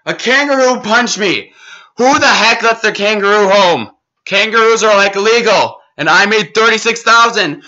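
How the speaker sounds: high, loud, breathless excitement; excited outrage. Real, not acted. Gamer telling viewers about the kangaroo and the loot, high excited shouting. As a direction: shout the win out, still rattled.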